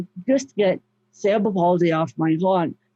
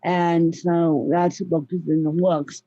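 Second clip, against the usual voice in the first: normal talking voice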